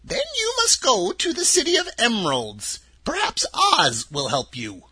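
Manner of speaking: put-on a sweet little old woman, high and gentle; old witch of the North. Witch of the North telling Dorothy her home, high and kindly.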